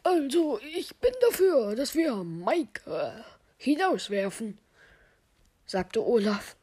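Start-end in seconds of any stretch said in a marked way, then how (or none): 0.0-4.6 high, loud opening that sinks as it slows; insistent accuser character. Acted. Olaf argues for throwing Mike out, insistent character voice. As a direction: propose it in a put-on, swooping voice. Olaf fordert Mikes Rauswurf.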